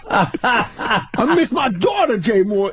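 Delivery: high, very slow, laughing whine; Tracy impression. As Tracy rambling to the comic, slow and whiny.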